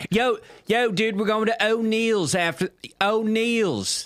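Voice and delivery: high-pitched Baltimore accent impression. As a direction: imitate a guy with a thick Baltimore accent. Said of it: "typical Baltimore accent".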